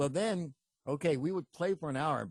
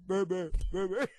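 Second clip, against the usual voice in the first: put-on "baby" blurred into a crude "beb-beb"; imitated deaf churchgoer; 3 semitones higher than usual. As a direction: echo "baby" as a mock garbled attempt. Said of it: "They'd go".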